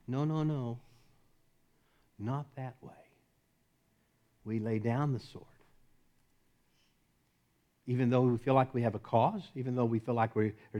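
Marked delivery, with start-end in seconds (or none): 0.0-5.5 soft, low, unhurried reply; gentle correction. Retold or imitated. Jesus answering the sword-bearer, softly correcting. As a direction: correct them quietly, with space between phrases. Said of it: "And Jesus said".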